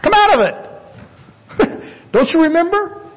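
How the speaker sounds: high, playful voicing of Jesus chiding friends; gentle rebuke. Jesus gently scolding the frightened disciples, high and laughing. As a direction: tease them out of their fear with a laugh. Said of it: "he rebukes them saying".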